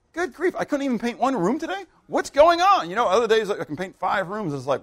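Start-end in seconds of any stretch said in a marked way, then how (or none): 0.1-2.9 high-pitched mock exasperation; exasperated complaint. Painter whose day flew by, exasperated. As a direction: complain in baffled, raised pitch. Acted frustration at a short day.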